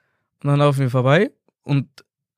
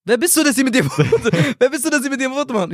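high, incredulous mock questioning; imitated baffled father; 10 semitones higher than usual. Father baffled that fans want photos, imitated incredulity. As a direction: re-enact dad's disbelief, breaking into laughter. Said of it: "Und mein Vater so".

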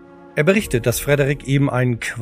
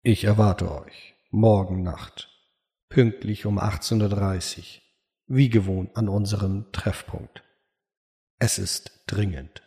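low, slow, hushed voice of the sheriff; slow, low-pitched summons, softly spoken; sheriff's message; 4 semitones lower, 4 dB quieter than usual. Acted. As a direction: read the note low and soft, with grave deliberation. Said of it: "die der Sheriff zuvor platziert hat".

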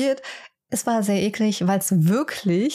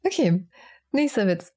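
ordinary delivery

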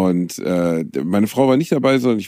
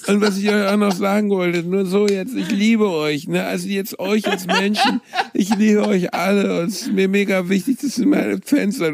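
higher, laughing mock-sincere declaration; higher giggly mock fan love; mock gushing star; 10 semitones higher than usual. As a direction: gush at fans like a hammy celebrity. Mock star addressing fans.

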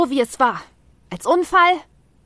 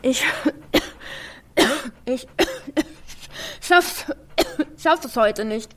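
gasping for air; struggling to breathe, halting; breathing into paper bag; 3 semitones higher, 4 dB quieter than usual. Acted. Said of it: "ich bekomme keine Luft". (nach Luft ringend)

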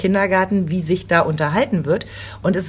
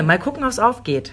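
"Mal gucken, ob's aufgeht" said higher and with an ironic, knowing lilt; wry repeat; 4 semitones higher than usual. Shown as a demonstration. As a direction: repeat it teasingly, as a wry warning. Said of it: "Das ist ja das Gemeine". ("Mal gucken, ob's aufgeht" höher, ironisch-wissend wiederholt)

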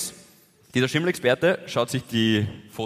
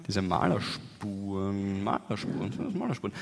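quiet musing; 6 semitones lower, 10 dB quieter than usual. Retold or imitated. Musing to himself about wall stains.

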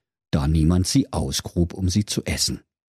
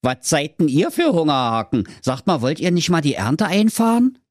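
high, cheeky Berlin voice; cocky Berlin hero; 8 semitones higher than usual. Acted. Schnitzelschorsch accepts the king's challenge, high cheeky Berlin voice. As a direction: toss off the challenge with swagger.

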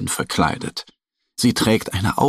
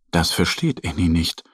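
ordinary delivery

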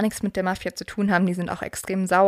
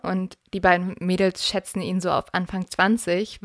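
normal talking voice

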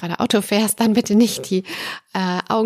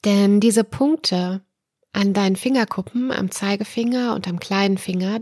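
guided relaxation. Acted. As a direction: ease the listener into calm. Leads a guided relaxation exercise.

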